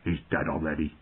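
low, soft, sullen killer; muttering intruder. A narrator's reading. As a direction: murmur it low and cold. Sour intruder justifying a killing.

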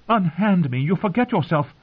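high, urgent rebuff; girl's indignant protest. A narrator's reading. Girl ordering the groping singer away, high indignant protest. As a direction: pitch it high and push him off.

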